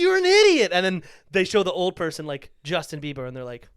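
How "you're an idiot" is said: high, loud mock outrage; exasperated yell. Viewer yelling at clueless quiz contestants, exasperated yell. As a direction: yell it in high-pitched disbelief.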